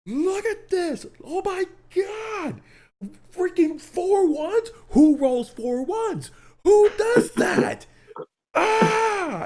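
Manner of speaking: very high, strained freak-out shriek, drawn-out words; mock-hysterical high scream; shrill retold yelling. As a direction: re-enact yelling at the top of your voice. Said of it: "at the top of my voice, like, shrills".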